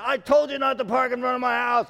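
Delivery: imitating yelling neighbor. Neighbor yelling about parking in front of his house, loud mock yell. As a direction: blast it like an outraged neighbor. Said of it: "He's yelling at me".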